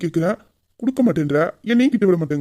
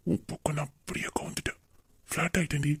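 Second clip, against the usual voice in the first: low, breathy confession; hushed, intimate sweet talk; amorous whisper; 6 semitones lower, 12 dB quieter than usual. Acted. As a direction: whisper it close, lovestruck. Confessing love up close.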